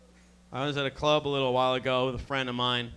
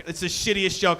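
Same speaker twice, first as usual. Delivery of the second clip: usual speaking voice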